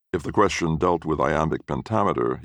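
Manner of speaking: usual speaking voice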